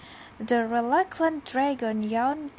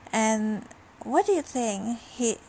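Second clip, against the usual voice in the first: ordinary delivery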